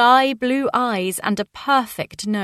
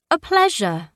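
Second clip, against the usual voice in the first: blurted; 7 semitones higher than usual. A narrator's reading. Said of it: "Daisy blurted out".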